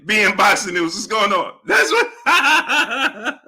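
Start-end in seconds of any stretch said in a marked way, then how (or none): 1.7-3.5 words breaking into high laughter; laughing. Real, not acted. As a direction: crack up as you speak. Breaks up laughing at his mimicry.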